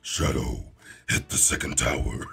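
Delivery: very low villain voice that cracks into a laugh; a deep menacing command, breaking into giggles; Doom voice. Acted. Streamer imitating Doom ordering Shadow, very low, laughing. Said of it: "I could be like".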